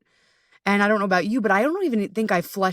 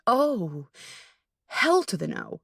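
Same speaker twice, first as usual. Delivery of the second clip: ordinary talk, nothing marked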